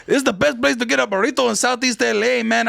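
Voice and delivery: put-on East LA Chicano accent; Cheech-style East LA accent. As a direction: do a mock East LA stoner accent. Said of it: "that is the East L A accent because it's Cheech".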